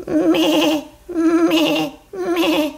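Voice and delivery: wavering imitated baa; sheep bleat. Little lamb asking to board the train, bleating. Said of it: "I am sheep".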